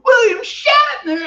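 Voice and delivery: high, drawn-out shocked yell; startled shout. Retold or imitated. Office worker spotting Shatner at the door, shocked high yell. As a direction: blurt his name in total shock. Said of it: "And I go".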